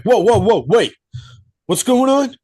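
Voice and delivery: high, loud alarmed whoa; startled deputy. Retold or imitated. Deputy caught off guard by the call, high and alarmed. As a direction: rear back in alarm, pitch jumping up. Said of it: "And he's like".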